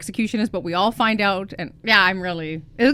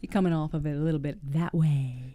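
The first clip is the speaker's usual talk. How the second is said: smooth Texas accent; 4 semitones lower, 7 dB quieter than usual. Shown as a demonstration. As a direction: let it roll off low like a Texan. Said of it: "where Matthew McConaughey's from".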